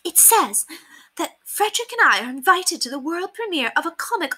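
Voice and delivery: high, smug lady showing off; high put-on boastful lady; boasting. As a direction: brag about the tickets. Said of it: "resumed her boasting".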